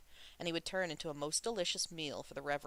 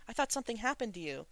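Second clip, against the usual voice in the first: hurried; 7 semitones higher, faster than usual. A narrator's reading. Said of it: "Shulian hurriedly asked".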